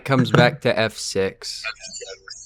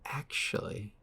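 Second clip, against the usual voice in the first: whispered; 4 semitones higher, 14 dB quieter than usual. Real, not acted. Thinking aloud mid-calculation.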